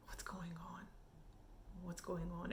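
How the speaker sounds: whispered, then very soft; retold stunned reaction, barely audible; hushed bewilderment. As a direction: mutter it under your breath, baffled. Retells baffled reaction to episode.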